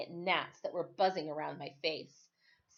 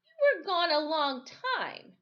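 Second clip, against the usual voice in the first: put-on higher, slow boy voice; 9 semitones higher, 4 dB louder, slower than usual. Suspicious boy accuses Timothy.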